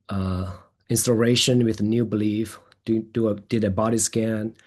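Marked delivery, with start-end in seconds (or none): none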